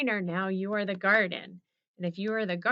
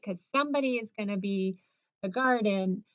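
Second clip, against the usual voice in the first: ordinary talk, nothing marked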